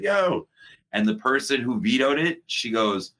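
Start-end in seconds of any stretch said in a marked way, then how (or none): 0.0-0.5 excited yo. Retold or imitated. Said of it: "I was like".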